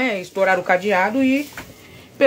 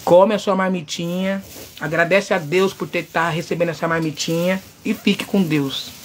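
tender forgiveness; 5 semitones lower, 4 dB quieter than usual. Retold or imitated. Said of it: "Eu olhei pra ele e falei".

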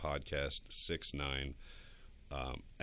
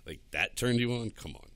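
louder and higher, scoffing disbelief; loud mock disbelief; 7 semitones higher, 6 dB louder than usual. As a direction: scoff at it, come on. Mocking the sexting, loud.

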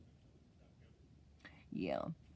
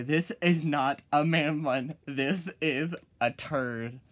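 louder, slow and emphatic; loud slow retort; 2 semitones higher, 7 dB louder, slower than usual. Shown as a demonstration. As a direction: lay it down firmly and loudly. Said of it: "I'll say".

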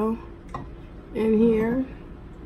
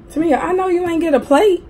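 teasing; 10 semitones higher, 8 dB louder, faster than usual. Real, not acted. Teasing her daughter over food.

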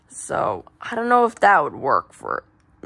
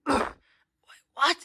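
usual speaking voice

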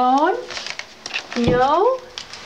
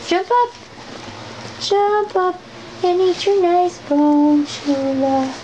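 a much higher sing-song voice, urging the dog up; high-pitched coaxing; 9 semitones higher than usual. Real, not acted. Owner coaxing her dog Chula to jump up, high sing-song. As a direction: coax the dog up onto the bed, way up high.

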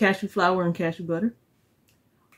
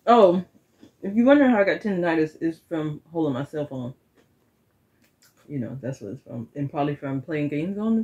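muffled through covering hand; talking with mouthful of cookie; chewing with hand over mouth; 4 semitones lower than usual. Real, not acted.

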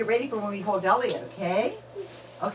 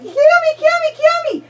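loud high squealed name; excited squeal; 8 dB louder than usual. Real, not acted. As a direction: call out with delight. Playing with babies.

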